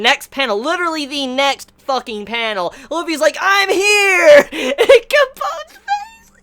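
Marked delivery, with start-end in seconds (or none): 3.4-4.5 loud, high triumphant holler; big mock-heroic entrance shout; Luffy's shout. Luffy announces himself in retold panel. 4.5-6.3 very high squeal, words stretched out; real laughing fit, voice squeaking; helpless laughter. Real, not acted. Helpless laughter at the character's face.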